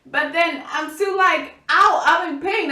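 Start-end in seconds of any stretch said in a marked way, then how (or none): 1.7-2.7 high, loud hammed-up "ow"; mock pained cry. Still hurting while meeting her father, mock pained complaint. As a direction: cry out as if it hurts, playfully overdone. Acts out her pain in retelling.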